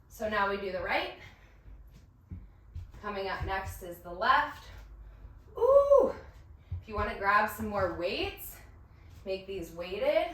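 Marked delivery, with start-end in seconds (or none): 5.6-6.1 high, loud squeal of delight; excited ooh. Real, not acted. Instructor squealing at the workout burn, high and loud.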